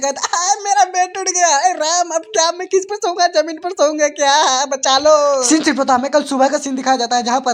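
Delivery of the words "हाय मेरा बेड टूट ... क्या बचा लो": high comic lament; mock wailing. A narrator's reading. Hero laments his collapsed bed, high mock wail. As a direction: wail dramatically in hammed-up despair.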